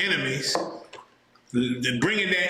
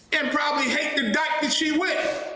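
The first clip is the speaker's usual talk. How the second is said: angry shout; 8 semitones higher than usual. Real, not acted. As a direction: shout the insult out in outrage. Ranting about the bailiff.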